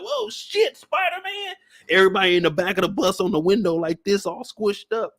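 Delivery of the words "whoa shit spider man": high startled exclamation; surprised gasp. Retold or imitated. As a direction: squeal it out in shock. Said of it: "You in there like".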